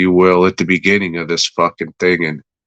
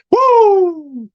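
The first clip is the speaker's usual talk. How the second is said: very high, loud whooping cry; mock flare whoop; 6 dB louder than usual. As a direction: whoop like a firework going up. Imitating the retreat flare.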